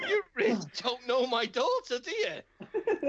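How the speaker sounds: high, laughing exasperation; exasperated. Retold or imitated. To the cashier about his daughter, a high laughing plea. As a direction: plead with a laugh. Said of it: "I said".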